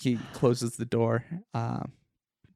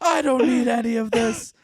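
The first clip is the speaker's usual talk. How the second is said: high, loud and accusing; angry raised voice; 10 semitones higher, 7 dB louder than usual. Acted. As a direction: yell at the intruder suspiciously. Tenant refuses to cooperate.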